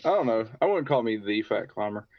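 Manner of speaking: ordinary talk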